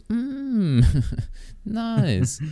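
high squeaky pleased voice; high mock delight. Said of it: "Ich so".

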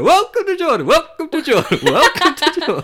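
high, loud, laughing welcomes in a row; varied sing-song welcomes. Retold or imitated. Road crews greeting walkers with laughing sing-song welcomes. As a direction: toss off each welcome with a new lilt. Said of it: "with different inflection".

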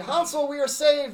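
excited cry. A narrator's reading. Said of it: "and cried".

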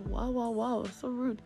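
ordinary delivery

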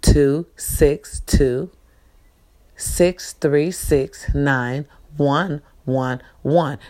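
slow dictation. Shown as a demonstration. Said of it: "The number is".

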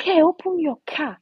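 high, loud opening, then easing off; retold shout. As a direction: imitate an aggressive shouted order. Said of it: "You're even shouting".

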